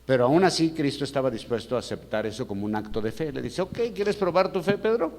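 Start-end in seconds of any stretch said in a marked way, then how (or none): 3.6-5.1 high-pitched rising question; playful challenge. Acted. Jesus asking Pedro to prove his faith, high challenge. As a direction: pitch it up into a teasing dare.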